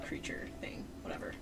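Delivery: hushed conspiratorial whisper; sneaky mock-secret whisper. Real, not acted. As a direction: whisper the denial to the audience. Secretive denial aside.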